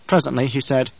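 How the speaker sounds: plain reading voice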